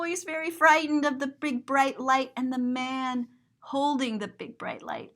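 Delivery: plain reading voice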